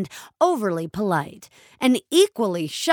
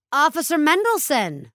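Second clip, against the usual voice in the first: a bright, higher call of his name across the room; cried out; 7 semitones higher than usual. A narrator's reading. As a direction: call out to him, pleased to see him. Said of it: "cried a policewoman".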